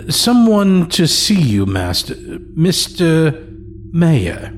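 a hint of a fussy old manservant; old servant announcing. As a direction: servant announcing a guest to his master.

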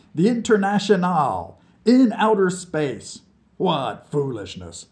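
put-on cheeky drunk from under the piano; mocking drunk. A narrator's reading. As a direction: poke fun at the crybaby. Vlad scoffing.

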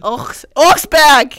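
loud, drawn-out mock German "Augsburg"; German accent. Answering a friend's request for an accent, loud mock German. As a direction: bark "Augsburg" like a caricature German. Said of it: "That was very German-like".

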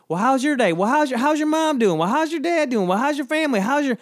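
high, sing-song small talk at the counter; a customer keeps chatting with the cashier, high and sing-song; chatty Southern customer. Retold or imitated. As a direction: play the endlessly chatty customer, pitched high. Southern line chitchat acted out.

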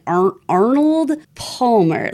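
slow, effortful "Arnold Palmer"; labored tongue twister. Shown as a demonstration. Co-host showing their tongue twister, "Arnold Palmer" slow and laboured. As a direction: stumble on the first syllable, then drag out "Arnold Palmer". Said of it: "it's so hard for me to say that word".

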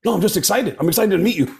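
high, startled protest; high excited denial. Retold or imitated. Chavo denying drugs to Gene, high and excited. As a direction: squeak out an eager denial. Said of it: "And he goes".

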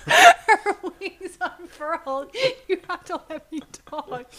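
high, loud, drawn out through laughter; giggly, slow and insistent; laughing. Real, not acted. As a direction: push the words out over giggles. Giggling, fighting to finish her sentence.

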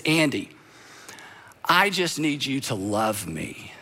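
soft, slow gentle plea; a mother's quiet tender request. Retold or imitated. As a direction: say it gently, the way his mother did. Mother's words quoted tenderly.

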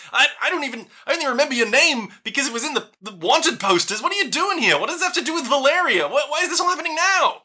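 high, rapid anxious interrogation; a tiny flustered scholar firing questions; keyed-up gnome professor. Acted.

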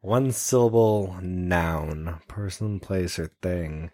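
put-on draws each word out very slowly, as if to a child; slow mock explanation. Repeating the host's request back very slowly to mock it. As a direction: spell it out slowly, mocking the instructions. Mocking the task by spelling it out.

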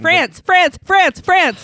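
loud, eager, rapid-fire repeat; excited shouting. Real, not acted. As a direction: blurt it out before anyone else. Shouting answer before question ends.

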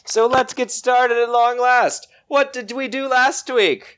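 high, slow storyteller opening; game master voice. Acted. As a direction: open the session like a host. Game master opens the session.

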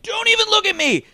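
very high, loud imitation of a yelling mom; mock angry yell. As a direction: shriek it like a hammed-up angry mom. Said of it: "gets yelled at by the mom".

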